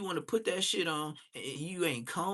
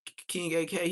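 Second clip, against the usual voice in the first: ordinary talk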